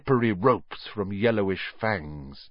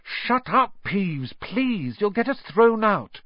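urgent plea; 10 semitones higher, slower than usual. A narrator's reading. Pleading with noisy poltergeist.

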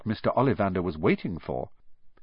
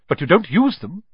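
higher and louder, cutting; sharp; 8 semitones higher, 7 dB louder than usual. A narrator's reading. Wandmaker questions Hagrid, sharp and a touch louder. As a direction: snap a quick check. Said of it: "said Mister Ollivander sharply".

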